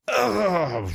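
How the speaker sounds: high strangled dying groan; death groan. Acted. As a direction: let the creature choke out its last breath. Monster dies, death groan.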